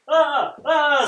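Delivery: high, loud startled cries; screaming ball. A narrator's reading. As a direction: shriek as the dog grabs you. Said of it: "screams the ball".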